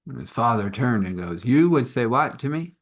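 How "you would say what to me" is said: indignant. Acted. Father outraged.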